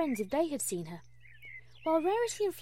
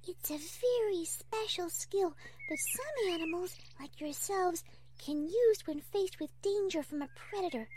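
Fluttershy; 6 semitones higher, 4 dB quieter than usual. Acted. Fluttershy lecturing the baby possums, high and gentle. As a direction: teach little ones in a small high voice.

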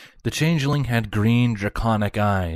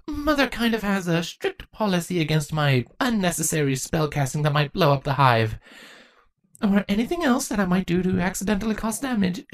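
put-on eager, nerdy young girl voice; gushing about history; bookish young changeling; 9 semitones higher than usual. Chrysalis, embarrassed.

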